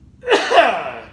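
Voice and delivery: a rough cough from the strain; involuntary cough; real cough. Real, not acted. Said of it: "That's exactly what that does to me".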